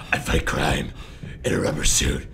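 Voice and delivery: Batman impression. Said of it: "the College Humor Batman ones".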